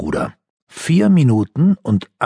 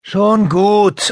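called out; 12 semitones higher, 4 dB louder than usual. A narrator's reading. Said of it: "rief Jessica".

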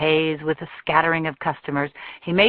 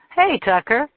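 high, louder hello; bright greeting; 7 semitones higher, 4 dB louder than usual. A narrator's reading. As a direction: greet your brother gladly. Josie greeting brother.

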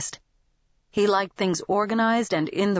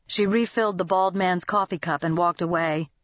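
ordinary delivery

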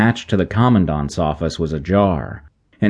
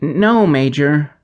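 hesitant; 8 semitones higher, slower than usual. A narrator's reading. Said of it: "Wynne hesitated".

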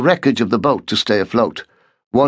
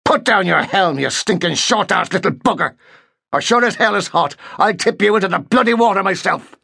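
raised, furious Irish-tinged threat; angry Irish threat; 9 semitones higher than usual. A narrator's reading. Markham threatens the midshipman, furious and Irish-tinged. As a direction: rage at the boy with an Irish lilt. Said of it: "level of his anger exaggerated the Irish inflection".

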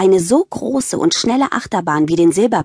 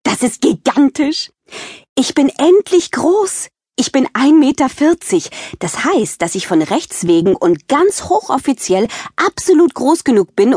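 thrilled; 7 semitones higher than usual. Acted. Girl thrilled at reaching height.

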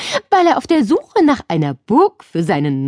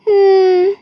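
high, louder doubtful hum; a girl hums, not convinced; unconvinced hm; 13 semitones higher, 6 dB louder than usual. A narrator's reading. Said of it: "machte Nele nicht besonders überzeugt".

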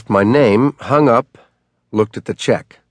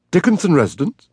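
high, thin male butler voice; a reedy servant answering the phone; 8 semitones higher, faster than usual. A narrator's reading. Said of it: "A reedy male voice answered".

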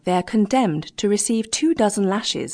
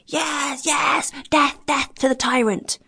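much higher, shouted cry for death; shouting crowd; 8 semitones higher, slower than usual. A narrator's reading. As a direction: yell it like an angry mob of sailors. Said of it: "shouted the greater number of the seamen".

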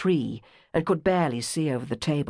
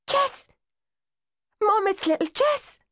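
much higher, a delighted shriek; girl shrieking to her mum, much higher; excited shriek. A narrator's reading. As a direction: squeal the name with glee. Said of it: "the girl shrieked".

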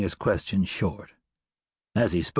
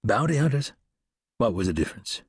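plain reading voice

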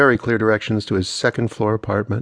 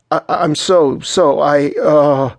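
high, loud, stumbling apology; tripping over the words, pitched up; flustered stammer; 5 semitones higher, 5 dB louder, slower than usual. A narrator's reading. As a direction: stammer over each word. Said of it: "and stammered".

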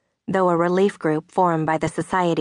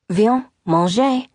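French call; 4 semitones higher, 4 dB louder, slower than usual. Acted. Veronique calls him to eat.

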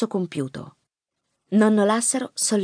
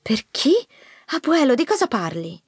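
higher, puzzled questioning; confused granddaughter; 7 semitones higher than usual. A narrator's reading. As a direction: ask in alarmed confusion. (nipote confusa, voce acuta)

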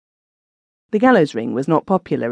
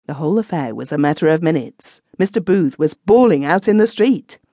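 usual speaking voice

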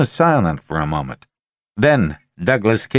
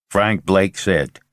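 ordinary delivery

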